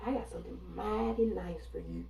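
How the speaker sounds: "I got something mighty nice for you" stretched out in a thick Southern drawl; a light Southern hospitality voice. Retold or imitated. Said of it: "how them Southern hospitality women say".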